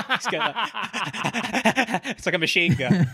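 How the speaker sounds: talking through laughter; giggling while talking; laughing. Real, not acted. Laughing at Peter's breathing.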